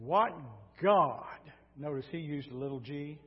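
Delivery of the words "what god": cocky king, pausing before GOD; acted royal arrogance; cocky challenge. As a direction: throw out a swaggering challenge. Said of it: "then he gets cocky".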